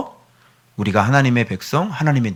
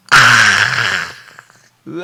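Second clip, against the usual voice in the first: normal talking voice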